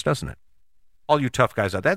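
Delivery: ordinary delivery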